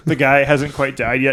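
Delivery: ordinary talk, nothing marked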